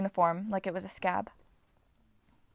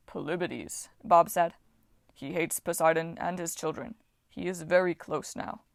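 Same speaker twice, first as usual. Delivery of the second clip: Titan voice; 3 semitones lower than usual. A narrator's reading. Voices Bob.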